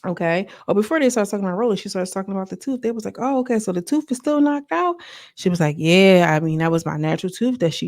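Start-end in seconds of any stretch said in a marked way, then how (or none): 3.2-5.0 high-pitched, rising nosy question; mock surprised question. They ask about the missing tooth, high imitated surprise. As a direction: imitate them checking, voice lifted way up.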